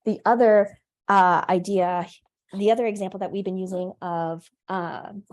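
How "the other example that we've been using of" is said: usual speaking voice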